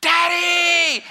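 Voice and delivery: imitating little girls' excited high squeal; high-pitched shout. Little girls getting off the bus to Dad, high-pitched shout. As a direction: cry out loud and very high, drawn out. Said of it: "It is the high pitch".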